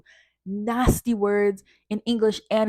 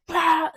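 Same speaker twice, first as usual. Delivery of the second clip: short higher barked "Bah!"; mock yell; 8 semitones higher, 5 dB louder than usual. Mimicking the teacher slamming the desk, short mock yell. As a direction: imitate his bang-and-shout. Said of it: "slamming the desk".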